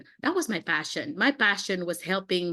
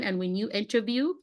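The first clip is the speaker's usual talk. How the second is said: ordinary delivery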